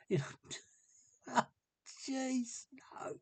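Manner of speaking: laughing through the words in disbelief; laughing at how absurd it was. Real, not acted.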